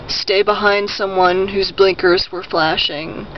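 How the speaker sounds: ordinary talk, nothing marked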